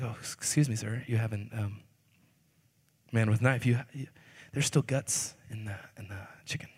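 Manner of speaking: nervously tiptoeing a complaint to the man with the knife; sheepish hushed protest that fades into a whisper; timid objection. Retold or imitated.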